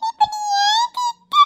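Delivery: high, drawn-out tearful wail; crying, slow and high-pitched. Acted. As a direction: wail the complaint through sobs. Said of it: "இப்ப எதுக்கு அழுதுட்டு இருக்க?".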